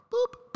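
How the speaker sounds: high squeaky boop; a sharp high boop as the hat goes. Retold or imitated. Flicking a friend's hat, squeaky boop. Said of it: "I'm like".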